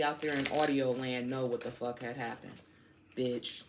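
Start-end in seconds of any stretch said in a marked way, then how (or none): none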